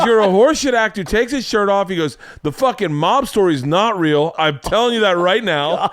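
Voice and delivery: mock angry rant.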